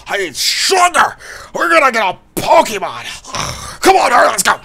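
exaggerated, excitable figure with stretched words; Shauna character voice. Player voices Shauna urging friends to hurry, exaggerated put-on voice. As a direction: ham up every exclamation.

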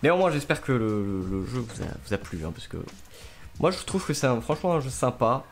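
normal talking voice

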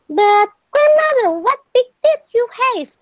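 put-on high, loud little girl's voice; high storybook girl, mounting amazement. A narrator's reading. Red marvels at the disguised wolf.

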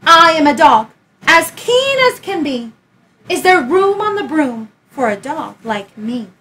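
eager dog. Acted. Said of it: "then eagerly said".

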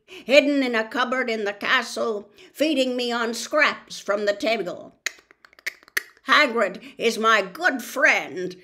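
put-on loud, strange old spider voice, angry; an ancient spider defending his friend. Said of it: "clicking angrily".

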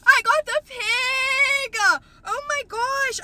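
very high, drawn-out squeal; real excitement. Real, not acted. Collector announces she got the pig, excited squeal. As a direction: squeal it slowly, overjoyed. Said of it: "'cause I'm so fucking excited".

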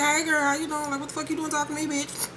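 hushed, fast catty aside; catty quiet greeting. Retold or imitated. Tierra's catty greeting retold.

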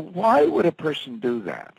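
ordinary delivery

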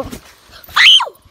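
high loud cry of pain; sharp yelp. Real, not acted. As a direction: shriek it out suddenly. Slips on trampoline edge.

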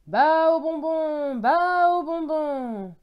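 loud, high, long-drawn vendor call; market seller's cry. A narrator's reading. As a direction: hawk sweets across a market, stretching each word. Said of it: "je criai".

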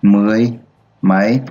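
word by word, long pauses between; spaced-out reading for pupils to follow; slow dictation. Shown as a demonstration. Teacher models reading the decimal to the class, slow and spaced. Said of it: "Đọc là".

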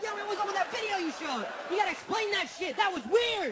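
high, loud baffled questioning; mock-bewildered audience member; incredulous demand. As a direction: call him out in disbelief. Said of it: "You'd be like".

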